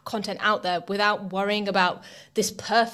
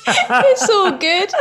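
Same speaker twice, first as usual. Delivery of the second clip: laughing; 11 semitones higher, 9 dB louder, much slower than usual. Real, not acted. Guest laughs at a name joke.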